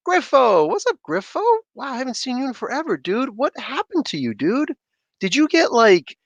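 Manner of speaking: delighted high greeting to a returning chat friend; excited greeting. Real, not acted. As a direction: pitch up, thrilled to see a regular. Greets long-lost chatter.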